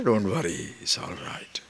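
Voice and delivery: hushed, almost whispered don't worry; soft reassurance. Acted. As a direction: reassure them gently, under your breath.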